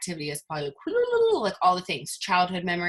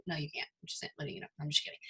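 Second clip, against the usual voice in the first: very soft, half-voiced undertone; joking muttered aside; 4 semitones lower, 12 dB quieter, much faster than usual. Teasing the doubters in a joke, hushed low aside. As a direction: drop right down under your breath.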